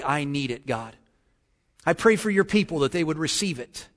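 low, hushed pastoral prayer; real prayer. Real, not acted. Pastor asks God to anoint his message, low earnest prayer. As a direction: pray softly and earnestly, pitched low.

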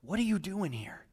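soft breathy echo of the question; hushed repeat. Acted. Preacher echoing God's question, hushed. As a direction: say it again very softly.

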